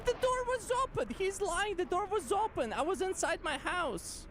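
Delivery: high-pitched cartoon protest; squeaky cartoon voice pleading; cartoon character voice. Real, not acted. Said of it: "you sound like a damn cartoon character".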